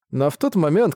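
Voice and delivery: ordinary delivery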